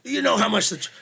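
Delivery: high, loud exasperated outburst; re-enacted angry yell, cut short; retold yelling. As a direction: act out berating the crew. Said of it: "we yelled for a minute".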